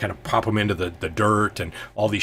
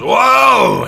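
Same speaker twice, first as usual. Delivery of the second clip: high, loud "whoa" as the footing goes; startled slipping yell; 10 dB louder than usual. Acted. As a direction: cry out like someone slipping off a ledge. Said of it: "his foot slips right on the edge".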